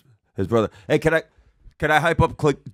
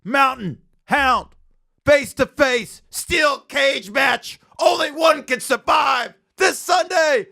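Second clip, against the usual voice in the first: wrestling promo; 7 semitones higher than usual. Pretend. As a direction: hype announcer building to a shout. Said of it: "let me cut a promo for it".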